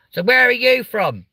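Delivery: high, loud "So where are you from?"; loud stage question. Retold or imitated. Comic on stage quizzing an audience member, loud and high. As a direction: call it out to the crowd like a host.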